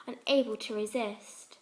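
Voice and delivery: ordinary delivery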